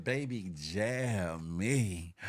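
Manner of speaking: slow, low, drawn-out murmur; slow low mock seduction; mock sultry quote. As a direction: stretch it out low and slow like a come-on. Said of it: "Definitely said".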